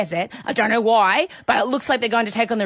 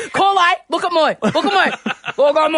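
laughing Aussie mum scolding, higher and louder; Aussie accent; 5 semitones higher, 4 dB louder than usual. Retold or imitated. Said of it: "Kylo Ren in an Aussie accent".

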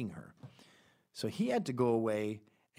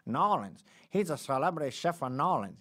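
louder, higher hammed-up Cajun accent; 4 semitones higher, 5 dB louder than usual. Podcast host mimicking locals talking about a chef, in a mock Cajun accent. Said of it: "how to do a Cajun accent".

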